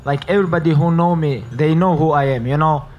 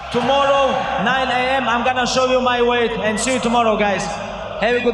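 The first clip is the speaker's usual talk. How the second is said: higher, raised call to the room; 7 semitones higher than usual. Real, not acted. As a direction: announce it to the crowd. Calling out to the crowd.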